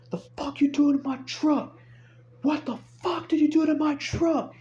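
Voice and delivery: high-pitched outraged yell; retold yelling. Truck owner confronting the tow driver, yelling. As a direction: imitate a man yelling, voice way up high. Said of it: "he started yelling, right?".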